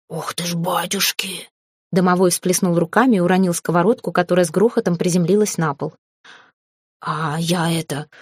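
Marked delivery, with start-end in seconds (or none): 0.1-1.5 soft, slow gasp of awe; stunned whisper. A narrator's reading. House spirit learns who the new owner is, stunned whisper. 7.0-8.1 slow, hesitant character voice; flustered house spirit. A narrator's reading. House spirit introduces himself to the new owner, slow and flustered.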